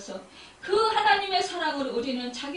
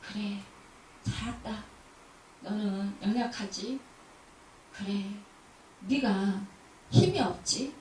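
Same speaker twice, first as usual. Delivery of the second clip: gentle comforting voice; 5 semitones lower than usual. Pretend. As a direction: soothe the weary like a kind father. God's gentle voice to sinner. (부드럽고 낮게 위로하는 목소리)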